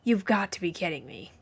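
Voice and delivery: understated exasperated groan. A narrator's reading. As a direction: sag into the line, fed up. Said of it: "before groaning".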